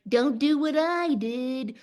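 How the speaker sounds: a slow, lightly voiced old person imitation; a hint of a tired old-timer warning you off; regretful old person. Old person warning the young not to repeat mistakes, lightly mimicked. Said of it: "the old people who didn't go for it and say things like".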